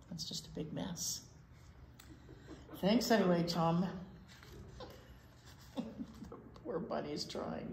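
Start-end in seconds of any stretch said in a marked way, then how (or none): 0.1-1.2 hushed whispered remark; whispered aside. Acted. Whispered aside about failed spaceship. 5.8-7.7 slow, hushed whisper of pity; whispered sympathy. Acted.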